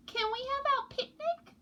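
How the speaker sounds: put-on very high, small child voice; little penguin voice. A narrator's reading. Penguin chick Pog pleads with parents, squeaky high. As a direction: ask like an eager tiny chick. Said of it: "said Pock".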